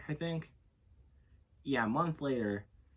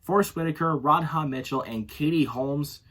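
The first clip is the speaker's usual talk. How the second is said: announcer intro; 2 semitones higher, 8 dB louder than usual. Acted. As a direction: announce the cast with weight. Loud, slow intro of cast.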